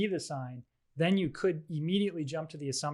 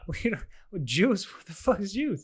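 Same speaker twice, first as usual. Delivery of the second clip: Chappelle bit quote; 5 semitones higher than usual. Retold or imitated. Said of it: "it's Chappelle, and they're like".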